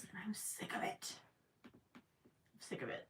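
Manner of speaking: very soft, near-whispered grumble; muttered complaint. Real, not acted. Complaining about a trendy song to viewers, muttered. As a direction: grumble it under your breath.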